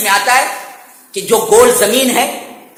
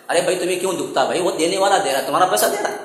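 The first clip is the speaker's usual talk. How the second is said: normal talking voice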